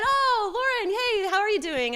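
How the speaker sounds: high, loud, bright hello; Jared welcoming her, high and loud; cheerful greeting. Retold or imitated. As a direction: greet her like a delighted friend.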